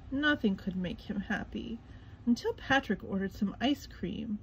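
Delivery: ordinary delivery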